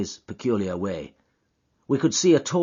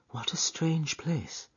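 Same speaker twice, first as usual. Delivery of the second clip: whisper; 7 semitones higher, 7 dB quieter, slower than usual. Acted. Miss Morstan in the dark grounds.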